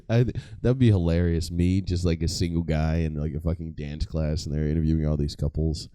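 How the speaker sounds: normal talking voice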